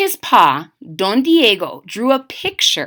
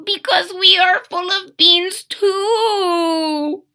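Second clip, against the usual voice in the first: higher, slow, earnest squeak; little mouse voice; 8 semitones higher, slower than usual. Acted. Poquito explaining to Skipito why the bandit comes. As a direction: answer like a worried little mouse.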